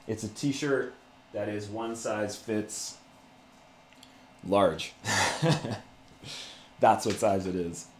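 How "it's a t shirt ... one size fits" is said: ordinary talk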